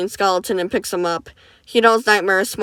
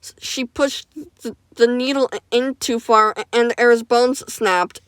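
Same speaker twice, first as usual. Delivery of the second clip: higher, halting stutter over each word; 3 semitones higher than usual. Acted. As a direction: stammer out the accident. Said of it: "stuttering while he talks".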